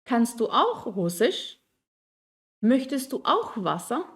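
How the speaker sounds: slow, rising to the end; yes-no tune. Shown as a demonstration. Teacher contrasting yes-no questions, rising. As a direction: let the yes-no question climb. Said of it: "Die Betonung ist anders".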